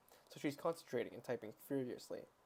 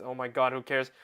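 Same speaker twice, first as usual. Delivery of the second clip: exasperated outburst; 10 dB louder than usual. Real, not acted. Reader scoffs at the book.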